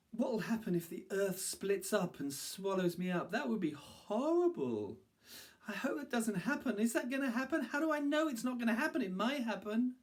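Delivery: high-pitched anxious fretting as a little monkey; nervous monkey. A narrator's reading. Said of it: "a nervous little monkey".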